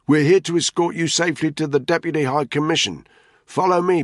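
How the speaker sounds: loud, high, urgent call over the engine roar; shouting. A narrator's reading. Danny calling Ntoga to follow him, loud shout. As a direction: keep yelling to be heard.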